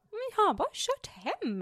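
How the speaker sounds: högt och ljust oskyldigt; låta helt ovetande; oskyldigt försvar. Retold or imitated. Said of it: "Jag bara". (ljust oskyldigt till pappa)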